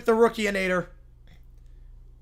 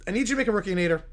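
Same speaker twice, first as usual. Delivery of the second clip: ordinary talk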